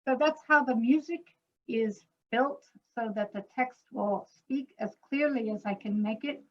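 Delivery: ordinary talk, nothing marked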